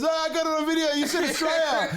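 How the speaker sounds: very high, laughing gloat; gleeful mate. Retold or imitated. AJ running over gloating about the video, high excited voice. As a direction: gloat like you caught him out. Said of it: "he goes".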